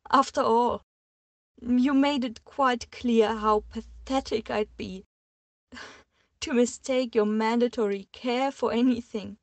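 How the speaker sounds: high, loud, with a bitter laugh; on the floor, bitterly mocking herself; bitter tearful outburst. A narrator's reading. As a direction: sneer at herself through tears.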